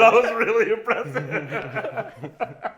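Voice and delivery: slow, high laughing praise. Real, not acted. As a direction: say it while cracking up. Laughing sarcasm.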